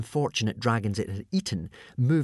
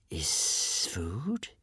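low, quiet musing hiss; hissing serpent; 4 semitones lower, 5 dB quieter than usual. Acted. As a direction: hiss it like a curious snake. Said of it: "hissed the serpent musingly".

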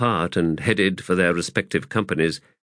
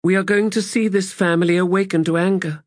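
put-on high, light child voice; young girl's voice; 13 semitones higher than usual. Child asking her mother's daughter about the family, high and young. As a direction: speak as a small wondering girl.

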